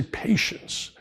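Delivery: a soft, breathy near-whisper of the calm owner; low-key. Retold or imitated. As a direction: hush it out, unbothered. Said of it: "he's very low-keyed".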